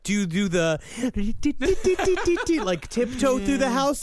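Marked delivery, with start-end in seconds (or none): 0.8-2.6 high, rapid "dee-dee-dee" sneaking sound; tiptoeing noises. Pretend. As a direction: make cartoon tiptoe noises out loud. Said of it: "making the noises out loud".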